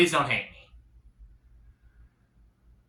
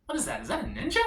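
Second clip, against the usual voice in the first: higher, softer wondering to himself; quiet surprised question; puzzled muttering; 9 semitones higher, 7 dB quieter, much faster than usual. Retold or imitated. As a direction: squint at the roadside in confusion. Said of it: "And I said to myself".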